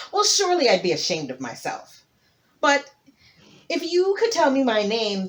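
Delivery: loud, self-assured boast; a king sure he can't lose; confident hippo king. Acted. Hippo answers tortoise.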